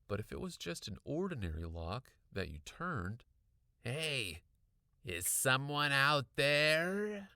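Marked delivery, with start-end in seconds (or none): 3.8-7.3 loud, drawn-out raspy call; raspy voice rising as he calls out; raspy Mister Mortman. A narrator's reading. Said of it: "Mister Mortman's raspy voice".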